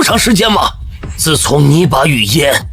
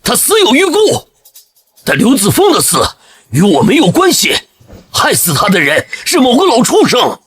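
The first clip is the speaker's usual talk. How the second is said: bitter denunciation; 5 semitones higher than usual. Acted. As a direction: pitch leaping high in vengeful anger. Vengeful father denounces the killer.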